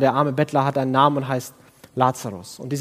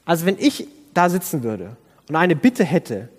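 ordinary talk

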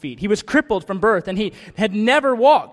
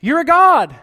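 loud, high cry of worship; a loud, high cry of awe at a hero; awestruck shout; 9 semitones higher, 10 dB louder than usual. Retold or imitated. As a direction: belt it out like a dazzled fan. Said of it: "I'd be like".

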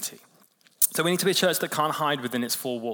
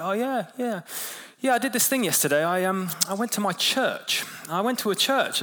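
imitating the colleague; 6 semitones higher than usual. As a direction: mimic the bloke telling his news, pitched well up. Colleague reports going to church.

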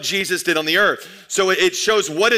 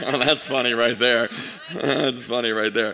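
laughing; 6 semitones lower, 3 dB quieter than usual. Real, not acted. Pastor laughing at slide.

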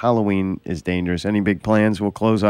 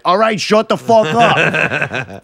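slow, high, louder fed-up snap; mock exasperation; 9 semitones higher, 4 dB louder, much slower than usual. Telling a party story, slow mock fed-up outburst. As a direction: drag it out, fed up. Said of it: "And then it's like".

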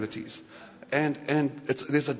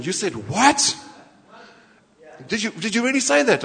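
louder astonished question, swooping up on "what"; incredulous; 5 semitones higher, 5 dB louder than usual. Acted. Reader on a third reading questioning Jesus, incredulous. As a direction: challenge it in loud, high disbelief. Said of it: "I'm incredulous".

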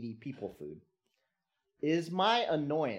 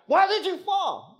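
put-on much higher and louder, exasperated at the hurt child; irrational anger; 11 dB louder than usual. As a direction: snap it at the crying child. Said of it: "my reaction is like".

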